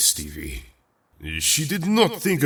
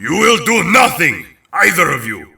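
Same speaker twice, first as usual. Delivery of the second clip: higher and louder, firm order; stern command; 6 semitones higher, 6 dB louder than usual. Acted. Peter orders both kids to stop, stern and loud. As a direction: halt them both at once.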